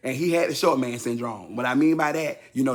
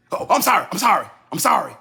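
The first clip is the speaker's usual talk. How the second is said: louder, higher rushed apology; imitating dad apologizing; 5 semitones higher, 6 dB louder, faster than usual. Dad apologizes on the phone, loud rushed apology. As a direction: blurt the sorries like a dad desperate. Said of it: "He be like".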